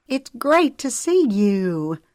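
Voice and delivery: put-on a warm, slow greeting to an old friend; gently, glad to see her. A narrator's reading. Zoe hugging Noelle, greeting her warmly and slowly. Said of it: "Zoe said warmly".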